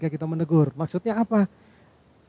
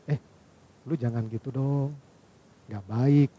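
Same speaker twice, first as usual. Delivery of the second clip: soft, lower gentle reproof; teguran lembut; 3 semitones lower, 4 dB quieter than usual. Shown as a demonstration. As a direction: correct a friend gently, keeping the voice low and soft. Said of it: "Dibanding kalau adik-adik ngomong begini". (menegur teman dengan nada rendah dan lembut)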